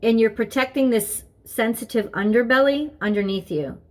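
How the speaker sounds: ordinary delivery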